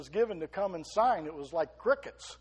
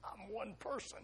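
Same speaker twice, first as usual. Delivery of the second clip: mock small self-doubting voice, softer; doubtful listener; 4 semitones higher, 9 dB quieter than usual. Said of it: "You say".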